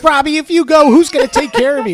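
high, loud mock little-sister plea; upset girl, imitated. Acting out girl pleading with brother.

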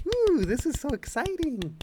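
high-pitched put-on squeal; mock excitement. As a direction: gush in a high imitating voice. Quotes an excited film line.

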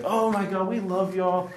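high-pitched fake gushing; mock someone cooing to kindergartners; kindergarten-style emcee. Emcee telling the church they are loved, mock kindergarten gushing. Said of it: "It's like talking to kin".

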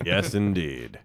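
put-on low, pompous agreement; deep, self-mocking affirmation. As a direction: drop right down low for a joke. Mock reply about voice getting deeper.

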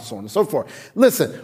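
ordinary delivery

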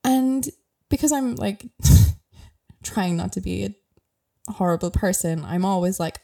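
ordinary talk, nothing marked

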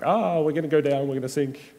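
a playful cry of alarm, voice up; mock panic. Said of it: "is terrified".

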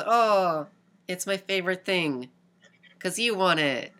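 mock-cute swooning over a prize horse; cute girlfriend voice. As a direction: coo it like a smitten eighth grader. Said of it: "And, like, being cute".

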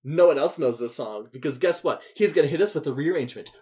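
normal talking voice

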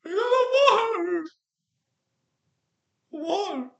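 high, loud whine climbing into a squeal, then fading off; mock whining. Mocking California crying about water, loud high squealing whine. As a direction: wail it like a petulant child, rising to a squeal and then dying away. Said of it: "Fucking grind".

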